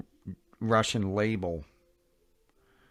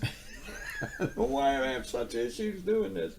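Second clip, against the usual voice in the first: high laughing cry, much higher; 10 semitones higher than usual. Real, not acted. Vlogger fumbling with camera, laughing at himself. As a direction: laugh through it at your own clumsiness.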